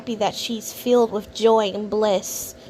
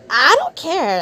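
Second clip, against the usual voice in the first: high, loud I don't care; louder, higher I don't care; loud insistence; 7 semitones higher, 6 dB louder than usual. Real, not acted. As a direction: snap it out defiantly. Insisting she doesn't care.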